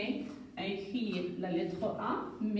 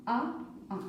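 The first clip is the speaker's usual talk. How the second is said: modelling the letter. Shown as a demonstration.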